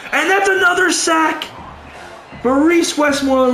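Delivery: high and pumped up; excited sack call. Real, not acted. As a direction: hype the defender's name. Defense gets another sack.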